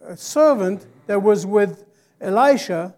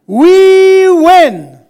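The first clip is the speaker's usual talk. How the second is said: higher and much louder, slow; preaching at full force; 9 semitones higher, 14 dB louder than usual. Acted. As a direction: proclaim it over the church. Call and response.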